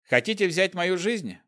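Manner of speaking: smiling, taunting question; with a smile. Acted. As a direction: grin through the challenge. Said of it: "спросил я с улыбкой".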